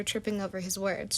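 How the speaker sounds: usual speaking voice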